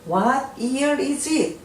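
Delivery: very slow, every word laid out; slow model reading. Shown as a demonstration. Teacher to Korean learners, slow model reading. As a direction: spell it out slowly for learners. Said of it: "teacher models English question".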